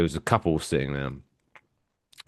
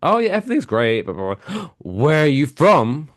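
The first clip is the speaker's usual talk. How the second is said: a bubbly, higher imitation of the wife; American wife, excited; 7 semitones higher than usual. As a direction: gush at the waiter like an eager tourist. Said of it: "his American wife, goes like".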